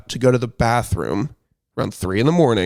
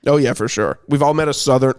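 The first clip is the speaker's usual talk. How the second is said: ordinary talk, nothing marked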